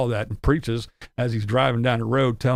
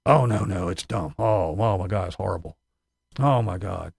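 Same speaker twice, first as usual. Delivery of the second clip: mock disgusted Tim; 6 semitones lower than usual. Mocking Tim's scorn for houses.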